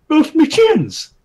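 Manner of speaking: put-on high, loud, slow comic old king; king's voice. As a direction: squeal it like a silly king. Narrator voices king's reply.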